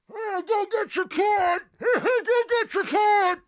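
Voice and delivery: high mocking shout out a car window; mocking drivers. Host imitating drivers jeering at patients in line, high mock yell. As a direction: jeer from a passing car, slow and high. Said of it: "they're going".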